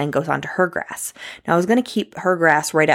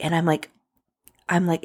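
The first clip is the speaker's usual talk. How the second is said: normal talking voice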